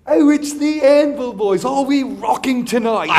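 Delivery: high, loud star's greeting; put-on, hammed-up rocker hail. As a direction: call it out high and slow, imitating a delighted star. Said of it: "he looks over and he goes".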